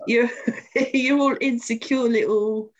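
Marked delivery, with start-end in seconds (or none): none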